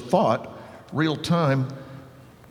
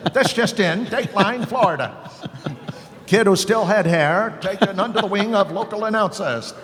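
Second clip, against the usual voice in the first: announcer voice; 8 semitones higher than usual. Retold or imitated. Kid playing radio announcer on the air. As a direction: punch it like a cheesy anchor. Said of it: "And I've got".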